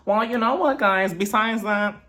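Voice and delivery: high, sing-song mock Meredith; imitating Meredith. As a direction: squeak it up like a giddy mom announcing news. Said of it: "Meredith also announces to both of them".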